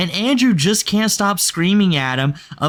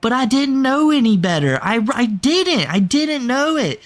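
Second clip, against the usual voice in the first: mock whiny innocent Andrew; 8 semitones higher than usual. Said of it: "and he's gonna say".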